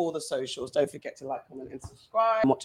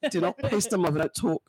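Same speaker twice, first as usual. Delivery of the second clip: usual speaking voice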